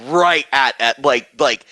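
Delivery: ordinary talk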